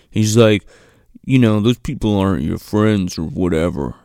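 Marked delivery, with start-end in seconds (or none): 1.2-3.9 low mock-boyfriend persuasion; imitated manipulative boyfriend. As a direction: drop your pitch, work on her like him. Boyfriend manipulating her in retold scene.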